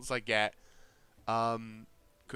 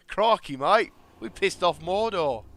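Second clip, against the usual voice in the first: loud, high, hammed-up Aussie; terrible Australian accent; 8 semitones higher, 13 dB louder than usual. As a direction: ham it up as a bad Australian. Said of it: "my terrible Australian accent".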